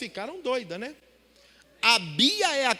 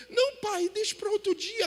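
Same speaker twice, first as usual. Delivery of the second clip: shy daughter protesting; 10 semitones higher than usual. Retold or imitated. Daughter hesitating at the door.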